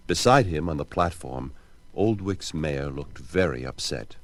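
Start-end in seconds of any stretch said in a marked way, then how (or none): none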